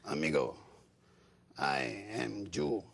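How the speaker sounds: put-on deep, slow Mexican-accented voice; low, slow and creepy, a Mexican man. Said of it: "and he says to me".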